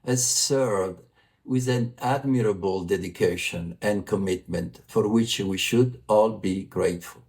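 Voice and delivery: normal talking voice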